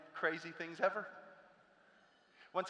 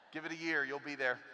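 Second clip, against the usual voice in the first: normal talking voice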